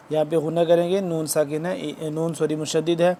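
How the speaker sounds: ordinary talk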